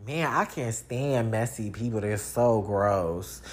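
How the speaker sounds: low, quiet, slowed mock disgust; a dry sneer at messy people, voiced small; imitating a disgusted visitor. Visitor scorning your messy house, in a quiet mock-disgusted voice.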